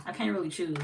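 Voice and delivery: ordinary talk, nothing marked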